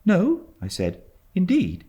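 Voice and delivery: high, earnest denial; boy protesting. A narrator's reading. Boy denying he came to beg, high and earnest. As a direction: insist like a wounded child.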